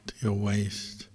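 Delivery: ordinary delivery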